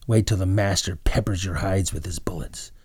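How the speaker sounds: ordinary delivery, nothing marked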